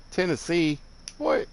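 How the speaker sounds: high, incredulous "Tennessee? What?"; mock-offended disbelief at the guess; playful disbelief, pitched high; surprised. Real, not acted. Mock indignation at viewer's guess.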